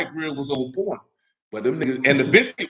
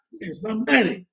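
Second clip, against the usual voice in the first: frantic; 6 semitones higher, slower than usual. Retold or imitated. Voicing someone who lost cash.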